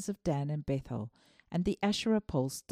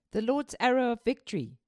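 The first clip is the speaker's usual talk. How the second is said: lightly acted proclamation, raised in pitch; declaring prophet; 6 semitones higher, 4 dB louder than usual. A narrator's reading. Dying Elisha proclaims the Lord's victory to the king, declaring. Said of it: "declared Elisha".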